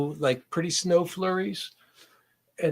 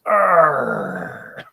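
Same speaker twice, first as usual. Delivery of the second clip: loud effortful grunt; 5 dB louder than usual. Real, not acted. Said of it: "and make old man noises".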